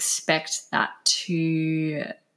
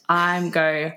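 ordinary delivery

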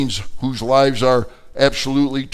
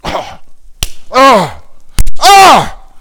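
rising, louder pained cries, hammed-up; mock labor cries; 12 semitones higher, 13 dB louder, much slower than usual. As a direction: climb into birthing-pain cries. Imitating labor pains.

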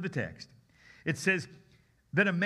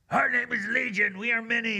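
higher, louder strange demon voice; multi-voiced demon; 6 semitones higher, 5 dB louder than usual. Shown as a demonstration. Preacher imagining the demon's voice for the congregation, high and strange. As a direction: sound like a crowd of demons. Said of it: "What do you think he sounded like".

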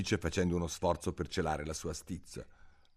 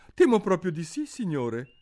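put-on much higher, louder little girl; girl Alice; 12 semitones higher, 8 dB louder than usual. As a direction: answer politely as a young girl. Alice risponde. (voce alta da bambina educata)

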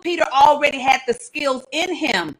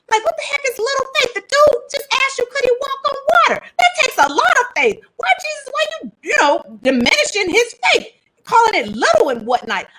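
indignant protest; 11 semitones higher than usual. Real, not acted. Preacher protesting Jesus' words.

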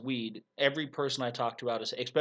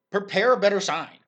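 much louder, higher incredulous rant; exasperated rant; 7 semitones higher, 13 dB louder than usual. Real, not acted. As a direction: shout it in mock disbelief. Ranting at silly summit signs.

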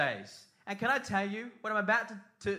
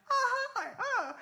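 high excited greeting; slower than usual. Retold or imitated. First day delight.

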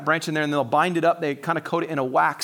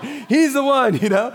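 laughing wife; 8 semitones higher, 5 dB louder, slower than usual. Retold or imitated. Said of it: "She's like".

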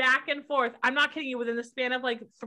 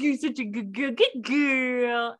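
praising the dog. Retold or imitated. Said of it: "I was like".

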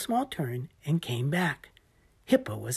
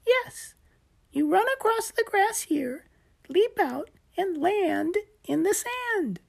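high little gecko explaining; patient gecko, much higher. Acted. Gecko voiced by narrator.

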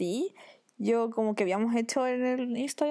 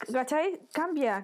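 usual speaking voice

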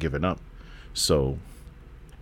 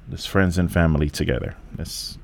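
ordinary talk, nothing marked

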